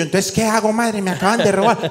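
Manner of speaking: high, rushed pleading; begging the shop lady; frantic plea to a shopkeeper after a robbery. Retold or imitated. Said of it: "Y yo".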